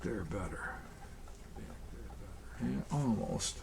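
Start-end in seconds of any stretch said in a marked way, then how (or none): 1.6-3.6 whispered mutter. Real, not acted. Muttering to himself while gluing.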